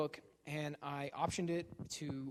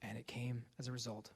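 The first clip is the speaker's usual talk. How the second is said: low, hushed dramatic buildup; hushed suspense; 6 semitones lower, 11 dB quieter than usual. Acted. Comic telling the audience about Oprah, hushed and ominous. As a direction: drop to a near whisper for suspense. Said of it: "dramatic hushed buildup".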